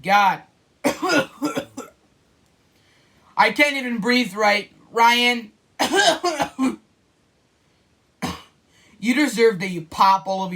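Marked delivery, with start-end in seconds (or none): 0.8-1.8 real coughing fit. Real, not acted. Choking on spray. 5.8-6.8 real coughing fit. Real, not acted. Choking on spray.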